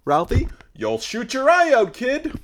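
high scolding movie-quote voice; Christmas Story quote. Acted. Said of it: "A Christmas Story".

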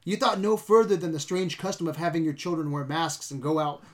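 normal talking voice

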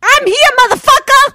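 high, fast, loud mock answer; defiant roll call. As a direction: yell it back high and cocky. Roll call comeback joke.

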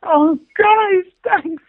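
high, teary mock gush; moved to tears. Playwright to audience members praising her play, mock-tearful thanks. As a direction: imitate being touched, high-pitched and welling up. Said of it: "I just sort of wanted to tears".

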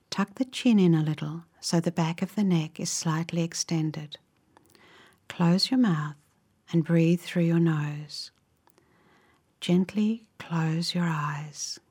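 unhurried, gentle phrases, leaving space to imagine; guided relaxation. Real, not acted. Guide leading listeners into a calming visualization, slow guided meditation.

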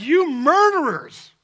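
brand them murderers, high and loud; accusing cry. Retold or imitated. Apostles confront religious leaders.

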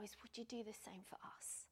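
a hushed, breathy whispered plea; whispered prayer. Real, not acted. Preacher praying to the Lord, whispered plea. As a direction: breathe the question to God, barely voiced.

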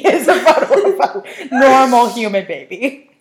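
laughing through the words; giggling while describing it. Real, not acted. Laughing at a search result.

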